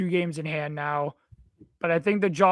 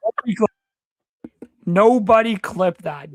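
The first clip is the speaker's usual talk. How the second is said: loud protest; 3 semitones higher, 9 dB louder than usual. Real, not acted. Cohost loudly begging chat not to clip, protesting. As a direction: beg them not to clip it.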